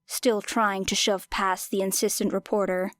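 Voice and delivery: ordinary delivery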